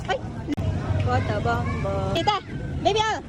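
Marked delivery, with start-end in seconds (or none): none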